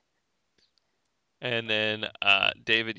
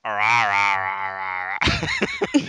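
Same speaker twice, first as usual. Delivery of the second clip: low growling zombie rah; zombie noise; 8 semitones lower than usual. Pretend. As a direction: groan it like a shambling zombie. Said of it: "That's my zombie noise".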